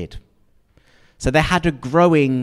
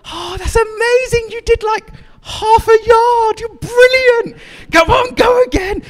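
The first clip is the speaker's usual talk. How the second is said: very high, louder, over-the-top delight; gushing praise; 5 dB louder than usual. Pretend. Parent gushing over child's half a yard, excited. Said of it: "And then you go".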